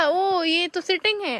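ordinary talk

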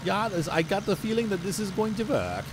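put-on a playful stab at a German accent. Farmer joking to viewers while baling, mild German accent. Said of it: "that's a German accent".

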